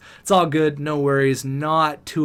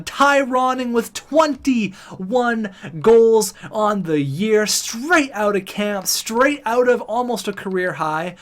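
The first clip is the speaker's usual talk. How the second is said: high, drawn-out excited announcer call; 7 semitones higher, 3 dB louder, slower than usual. Real, not acted. Streamer reading a player's goals, excited. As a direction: stretch the words out, pitched high with excitement. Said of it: "I got a little bit excited there".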